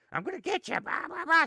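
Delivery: high, lightly voiced teasing puppet menace; a hammed-up puppet creeping up on a baby; mock spooky puppet. Big brother scaring the newborn with a puppet, mock menace. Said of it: "traumatize him with it".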